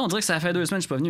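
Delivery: words tumbling out fast and garbled; tongue-tied fast mumble; stumbling rush. Real, not acted. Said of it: "j'ai donc bien de la misère à parler".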